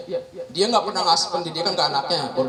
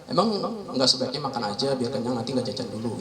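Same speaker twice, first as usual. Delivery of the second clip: lebih pelan dan lebih rendah, menasihati anak dengan halus; lembut; 6 semitones lower, 3 dB quieter than usual. Shown as a demonstration. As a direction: bujuk anak dengan sabar, suara turun. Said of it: "kata-kata mungkin yang lembut, yang halus". (nasihat lembut orang tua kepada anak)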